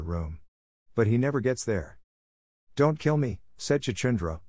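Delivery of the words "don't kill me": higher-pitched, understated tearful plea; almost weeping. A narrator's reading. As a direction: plead with a touch of tears. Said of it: "almost weeping".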